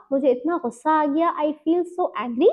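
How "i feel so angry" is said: very slow, each word weighed; name the feeling slowly and honestly; slow, owning the anger; slow sincere admission. Shown as a demonstration. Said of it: "वो माफ़ी जो है वो शुरू इस तरह होगी".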